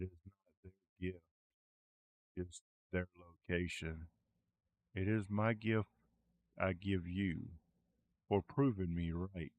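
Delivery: hint of a divine voice, first words quick; god in your head. A god speaks in the player's head, lightly voiced.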